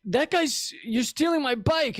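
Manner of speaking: high-pitched indignant complaint; mock playground protest; protesting victim. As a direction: cry foul like a bullied kid. Victim complaining about the bully.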